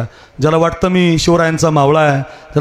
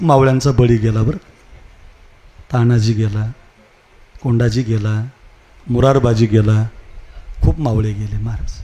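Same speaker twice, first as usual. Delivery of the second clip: solemn mourning; 5 semitones lower than usual. Acted. Mourning fallen warriors.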